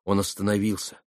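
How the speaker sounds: ordinary delivery, nothing marked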